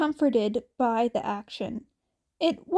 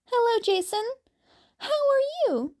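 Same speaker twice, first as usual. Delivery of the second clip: a much higher, eerie sing-song greeting; creepy high sister; 11 semitones higher, faster than usual. Acted. Cass sneaking up behind Jason, high eerie greeting. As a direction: float it up high and sweet like a ghost.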